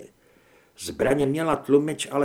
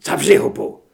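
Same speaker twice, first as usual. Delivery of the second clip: loud furious yell; enraged shout; 2 semitones higher, 8 dB louder than usual. Acted. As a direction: bark it down at him. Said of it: "zařval".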